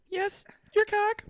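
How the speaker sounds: high, slow granny voice; granny saying it plainly; foul mouthed granny. Acted. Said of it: "my grandma voice is not good".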